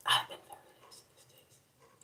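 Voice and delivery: full whisper, no voice; whispered confession of laziness. Real, not acted. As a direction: whisper it like a secret.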